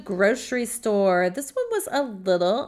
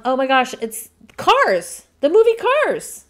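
excited realization; 3 semitones higher, 8 dB louder than usual. Real, not acted. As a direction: burst out with the answer you just solved. Solving a guessing game.

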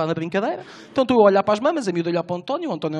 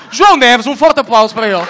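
loud announcer call for applause; announcer intro; 4 semitones higher, 11 dB louder than usual. Real, not acted. Introducing his friend to the crowd, big announcer voice. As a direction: boom the name like a ring announcer.

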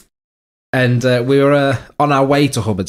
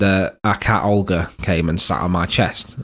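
ordinary delivery

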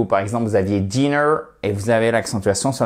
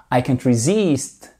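resist lent, accentué sur -SIST; étire la fin de resist; accent sur la seconde syllabe. Shown as a demonstration. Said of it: "votre accentuation sur la seconde syllabe". (resist, seconde syllabe appuyée et allongée)

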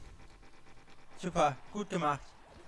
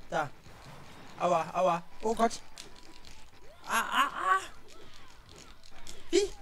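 panicking gamer; 5 semitones higher than usual. Real, not acted. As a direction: yelp in panic. Getting hit in the game.

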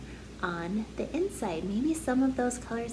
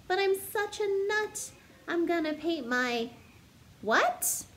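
bouncy storybook narration building up the list; story reading; 3 semitones higher than usual. Acted. Teacher reading a silly picture book to kids, bouncy storytelling. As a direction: read it like a picture book to children. Sings-songy refrain, teasing guesses.

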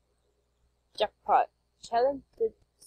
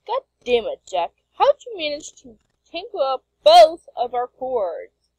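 loud, higher-pitched scolding; exasperated outburst; 5 semitones higher, 10 dB louder than usual. Acted. Comic character scolding Jack over tangled cords, exasperated outburst. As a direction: blow up at a clumsy friend.

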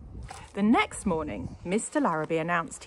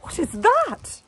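high, loud, slow question; puzzled wife; 12 semitones higher, 9 dB louder, slower than usual. A narrator's reading. Wife asking husband about the find. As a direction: ask in astonishment. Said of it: "asked his wife".

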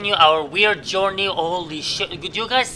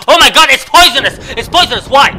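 higher, much louder alarmed cry; shocked yell; 5 semitones higher, 9 dB louder than usual. Real, not acted. Gamer yelling after eating a poisonous apple. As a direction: shout out the shock.

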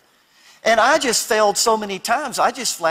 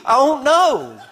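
hammed-up high wail, falling at the end; mock crying; 8 semitones higher, 4 dB louder, slower than usual. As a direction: imitate a sobbing child who has no answer. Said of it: "I'm crying".

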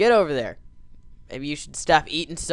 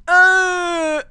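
sudden high yelp of fright; a shrill startled cry; 9 dB louder than usual. Real, not acted. Startled mid-jump in game.

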